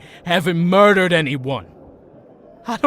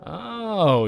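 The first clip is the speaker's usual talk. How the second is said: usual speaking voice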